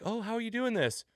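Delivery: high, hammed-up astonishment; imitating gasping onlookers; mock amazed crowd. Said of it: "They're all like".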